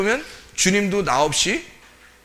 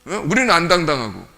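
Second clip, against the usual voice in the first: normal talking voice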